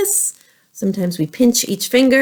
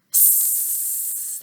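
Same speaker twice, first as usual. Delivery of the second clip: long, steady hissing snake sound; 19 dB quieter than usual. Shown as a demonstration. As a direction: hiss like a snake on one long breath. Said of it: "What's a snake say?".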